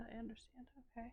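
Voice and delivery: breathy whispered aside. Real, not acted. Streamer whispering to herself while reading chat. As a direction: drop to a whisper. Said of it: "whispered repeat".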